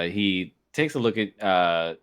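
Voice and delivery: ordinary talk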